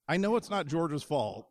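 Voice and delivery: usual speaking voice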